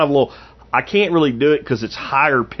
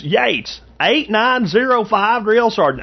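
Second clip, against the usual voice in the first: much higher, slow Southern drawl; Yates's Southern drawl; 9 semitones higher, slower than usual. Retold or imitated. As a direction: drawl out the number like the recruit Yates. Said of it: "that dude's voice was so specific".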